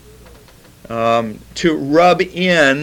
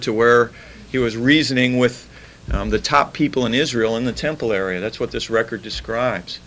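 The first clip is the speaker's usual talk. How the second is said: ordinary talk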